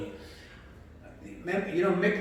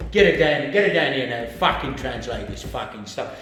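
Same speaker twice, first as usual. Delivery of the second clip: imitated boss, loud and demanding; barked order; 6 dB louder than usual. Boss ordering the wife brought down to translate, barking. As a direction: punch it out loud, easing at the end.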